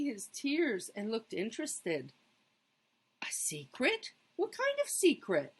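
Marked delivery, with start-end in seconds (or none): 3.2-5.5 put-on high, eager child voice; curious young Chester. A narrator's reading. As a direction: perk up with sudden curiosity.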